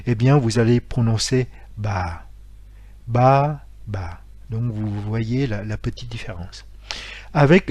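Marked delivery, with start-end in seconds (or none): 3.1-3.6 ba sur un ton descendant; ba, tonalité qui descend; grave tone. Shown as a demonstration. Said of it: "avec un accent grave". 3.9-4.2 ba, voix qui tombe plus bas; ba qui descend et s'éteint; low falling grave tone. Shown as a demonstration. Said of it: "avec un accent grave".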